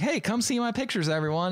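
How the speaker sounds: high, cheery imitated invitation; playfully acted eager announcement; mock calling out. Retold invitation to people at bowling alley.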